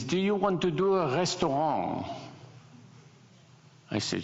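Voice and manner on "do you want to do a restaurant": high, put-on French accent; thick French accent. French gentleman offering work to a designer, mock French accent. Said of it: "in this really thick French accent".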